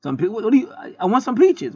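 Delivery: mock confused customer. A customer pleading with the clerk for peaches, high and baffled. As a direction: stammer like a baffled buyer. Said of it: "customer answering clerk".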